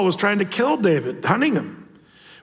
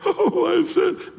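high, slow, hammed-up sob; mock wailing; 12 semitones higher, slower than usual. Shown as a demonstration. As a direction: overact the tearful confession. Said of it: "no weeping or crying".

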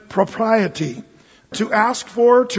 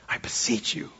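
breathy whispered plea, pleading; hushed pleading whisper; 12 dB quieter than usual. Acted. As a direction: lean in and whisper it like a secret begging. Pastor voicing Paul's plea softly.

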